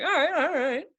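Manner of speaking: high-pitched, backing off; lightly acted hands-off surrender; mock placating. Calming the upset dancer, high and placating.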